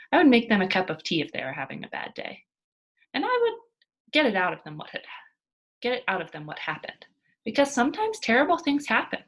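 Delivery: earnest, careful recitation of her own essay; reading an essay aloud. Acted. Mia reading her motel essay, earnest and careful. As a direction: read it like a heartfelt school essay.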